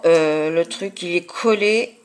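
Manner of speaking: ordinary talk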